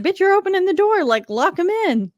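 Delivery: fast, high exasperated yell at screen. Retold or imitated. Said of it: "And I was like".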